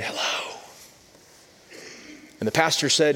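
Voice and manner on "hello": put-on a child's small hushed whisper; soft whispered hello on the phone. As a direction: breathe it out like a hiding four-year-old. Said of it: "And he whispered and he said".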